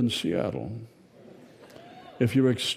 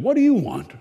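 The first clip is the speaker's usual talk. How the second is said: high, loud woman's voice from the fish; a woman's voice booming out of a statue; 12 semitones higher, 6 dB louder, faster than usual. A narrator's reading. Giant walleye statue questioning the little girl, high and loud. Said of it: "It was a woman's voice".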